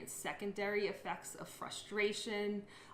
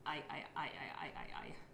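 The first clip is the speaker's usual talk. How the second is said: whispered word repetition; 4 semitones lower, 6 dB quieter than usual. Shown as a demonstration. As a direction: repeat "I" in a whisper. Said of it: "if I was gonna say the word I".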